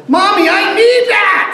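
put-on high, loud little-boy shout; child yelling. Boy yelling at his mom again for the yellow truck, high and loud. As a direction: demand it again like a stubborn toddler. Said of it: "And again he yelled".

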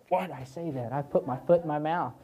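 low, soft mock self-reproach; regretful. College student regretting his pledge, rueful. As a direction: voice a rueful second thought.

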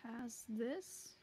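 usual speaking voice

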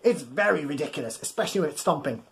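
ordinary delivery, nothing marked

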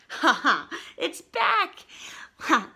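loud happy laugh; real laugh. Real, not acted. Dot back, reader laughs.

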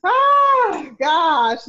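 a real burst of surprise; excited outburst. Real, not acted. Host bursts out during the live chat, loud and high.

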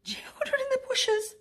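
scared whisper, pitched high; a terrified little monster's squeaking whisper; breathless, frightened, high whisper. A narrator's reading. Pog terrified by the bushes.

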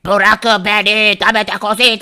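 very slow, stretched alien voice; jailed alien pleading to be freed. Acted. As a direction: drag out bırakın beni like a strange alien. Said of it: "yeşil uzaylının sesi bile değişmiş".

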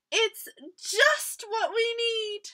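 high, pleased announcement; delighted mouse. A narrator's reading. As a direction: welcome it with storybook delight. Mouse happily welcomes the hole.

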